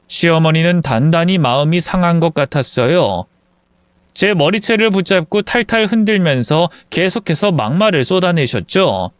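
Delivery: ordinary delivery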